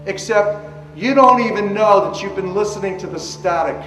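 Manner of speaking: ordinary delivery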